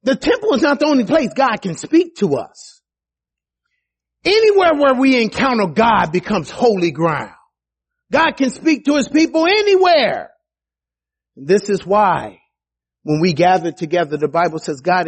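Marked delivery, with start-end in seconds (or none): 0.0-10.3 high, urgent defense in court; Stephen pleading. Acted. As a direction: talk the court down, insistently. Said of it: "He said".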